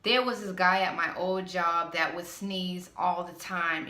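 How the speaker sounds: ordinary talk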